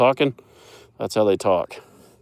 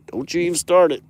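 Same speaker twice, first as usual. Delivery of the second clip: higher and louder scolding, pressing on start; raised-voice telling-off of the cow; sharp scold; 8 semitones higher, 6 dB louder than usual. Real, not acted. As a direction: snap the warning out louder.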